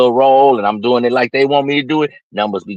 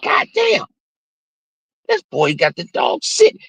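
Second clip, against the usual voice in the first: amazed exclaim. Retold or imitated. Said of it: "bam, you like".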